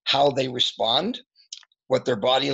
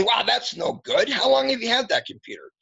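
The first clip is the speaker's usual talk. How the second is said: condescending tech support; 11 semitones higher than usual. Retold or imitated. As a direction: play the support guy talking down, pitched high. Mocking support guy quoted.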